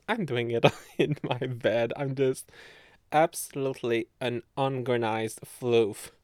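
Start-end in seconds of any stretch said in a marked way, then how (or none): none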